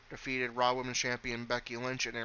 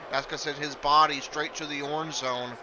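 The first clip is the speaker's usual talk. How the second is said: energetic live wrestling call, a bit louder; play-by-play commentary; 2 semitones higher, 3 dB louder than usual. Acted. Commentator calling the match for viewers, energetic play-by-play. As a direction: call the match as it happens, lifting on big moves.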